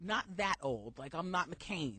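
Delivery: ordinary delivery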